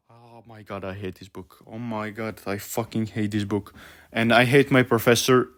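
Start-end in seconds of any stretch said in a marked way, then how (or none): 0.1-3.7 very soft, low seething; hushed, drained exasperation; exasperated muttering. Real, not acted. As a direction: mutter it low, utterly fed up. Spent after the frustrated outburst.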